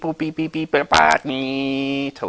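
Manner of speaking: high-pitched imitated modem beeping; modem beeps. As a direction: beep like an old dial-up modem. Imitating dial-up modem noises.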